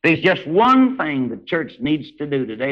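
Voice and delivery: ordinary talk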